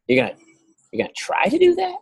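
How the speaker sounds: high, fast mock disbelief; incredulous. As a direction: pitch shooting up in disbelief. Incredulous at excuse.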